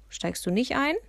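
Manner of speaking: high, rising called question; calling over. A narrator's reading. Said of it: "rief mir der Betreuer plötzlich zu". (fragend herübergerufen, mit hoher Stimme)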